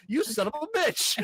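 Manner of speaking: high, slow put-on outraged voice; hammed-up betrayal; mock outrage. Acted outraged insult in retelling.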